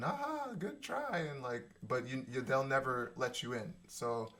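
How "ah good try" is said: high, soft, slow patronizing "Ah, good try"; condescending praise. Retold or imitated. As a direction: pat them on the head with your voice. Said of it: "smiles and".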